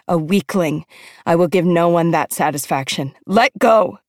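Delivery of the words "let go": sharp, higher outburst; harsh command. A narrator's reading. Older sister fighting back tears, harsh command. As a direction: snap the order out. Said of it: "I say harshly".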